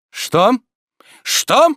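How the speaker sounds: high, loud, indignant question; menacing. A narrator's reading. Master raising his head at servant's roar, menacing. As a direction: fire it back as a threat. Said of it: "грозно заговорил Обломов".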